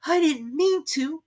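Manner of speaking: put-on slow, high pleading girl. Alice pleading with the mouse, slow and high. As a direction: plead gently. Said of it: "pleaded poor Alice".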